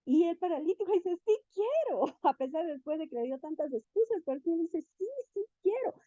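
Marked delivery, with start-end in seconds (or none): 1.2-2.0 high-pitched, mildly mock eagerness; imitated eager reply. Voz del paralítico que acepta sanar. 5.0-6.0 high-pitched, mildly mock eagerness; imitated eager reply. Repite la respuesta del paralítico.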